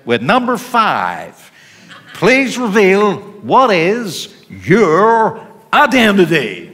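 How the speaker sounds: a deliberately bad American accent, drawn out like a TV host; poor American accent. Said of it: "forgive me for my very poor American accent".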